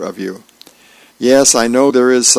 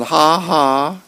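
hammed-up "ha, ha", higher pitched; mock laughing; 7 semitones higher, 4 dB louder than usual. Carpool adults running around outside the car, mock laughing. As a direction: read out a cartoon laugh, raised and playful. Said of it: "running around and going".

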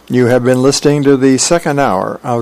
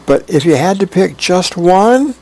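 higher, rising plea; pressing question; 8 semitones higher, 3 dB louder than usual. Acted. Husband pressing wife to pick one, coaxing question. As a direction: press for an answer, lifting the pitch.

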